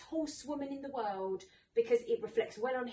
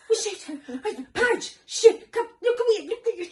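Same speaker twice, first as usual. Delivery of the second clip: mock high, loud yelling from the sidelines; imitating the father bellowing at his daughter on the pony; mock frantic dad; 8 semitones higher, 12 dB louder than usual. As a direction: ham up an overexcited dad shouting at his kid. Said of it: "he sits next to me like".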